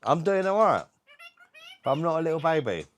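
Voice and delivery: high, loud sing-song for a bird; parrot coaching voice. Shown as a demonstration. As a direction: pitch it up so the parrot copies. Speaking for the parrot.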